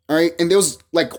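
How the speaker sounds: normal talking voice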